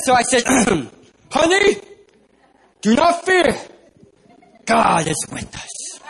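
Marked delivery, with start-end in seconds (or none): 1.3-6.0 put-on preacher voice, high then dropping slow; man of God. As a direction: impress her with mock-solemn assurance, stretching the last phrase. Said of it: "Trying to sound like a man of God".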